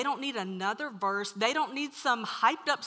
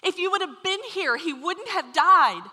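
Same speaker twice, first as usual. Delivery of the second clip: grieving reproach; 7 semitones higher, 9 dB louder than usual. Retold or imitated. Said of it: "they're saying things to him like".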